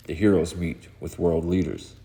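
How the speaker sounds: usual speaking voice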